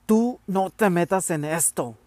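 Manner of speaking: loud angry yell; shouting. A narrator's reading. Father shouting at mother as he pushes her aside, loud angry yell. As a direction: snap at her to stay out of it. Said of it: "gritó".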